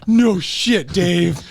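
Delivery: high, loud, gleeful confession to Dave; gleeful disbelief. Retold or imitated. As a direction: blurt it out delighted at the coincidence. Telling Dave he used his dock.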